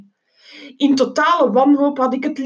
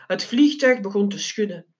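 ordinary delivery

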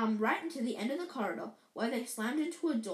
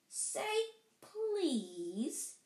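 Peeves; 8 semitones higher than usual. Acted. Poltergeist toying with Filch, sing-song teasing. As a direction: draw it out to taunt. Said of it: "in his annoying sing-song voice".

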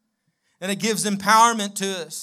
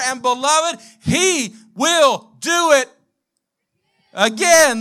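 loud, rising preacher's climax, higher and louder; full-force preaching; 4 semitones higher, 5 dB louder than usual. Acted. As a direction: build the sermon to a roaring peak.

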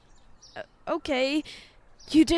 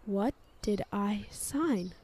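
breathless whisper; 8 dB quieter, slower than usual. Acted. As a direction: whisper it in dawning dread. Stunned realization.

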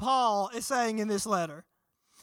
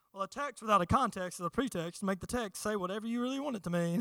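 quick, softer mock-lecturer delivery; stuffy professor; 4 semitones lower, 7 dB quieter, faster than usual. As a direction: parody a pompous seminary teacher. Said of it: "They'd be like".

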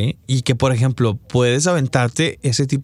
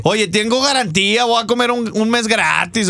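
high, teasing mock buyer; mock customer voice; 5 semitones higher than usual. Friend imitates a customer teasing about the warranty, high and mocking. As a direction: tease the seller like a cheeky customer. Voicing a joking Mexican customer.

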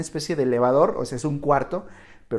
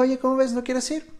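higher, light invitation; friend's voice; 10 semitones higher than usual. Retold or imitated. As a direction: voice the friend asking.

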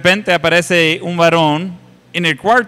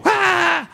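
sudden high yelp of fright, acted out; startled shriek; 14 semitones higher than usual. Preacher acting out a startled person: a high yelp. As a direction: jump and let out a high shriek.

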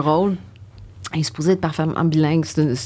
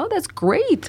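high-pitched imitation of delight; mock-gush in a raised voice; impressed auditioner; 11 semitones higher, slower than usual. Auditioners hearing her invented British accent, high mock delight.

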